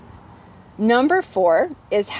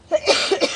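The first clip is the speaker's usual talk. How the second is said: a sharp real cough from a dry throat; sudden cough; 8 semitones higher than usual. Real, not acted. Coach losing his voice mid-lesson, coughing. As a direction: cough once, caught off guard. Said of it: "I get a little choked here".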